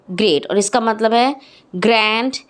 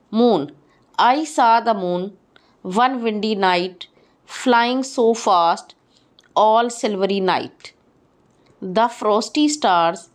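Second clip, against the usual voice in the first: poem recitation; much slower than usual. Acted. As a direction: read it slowly like a bedtime poem. Reads the poem Moon aloud.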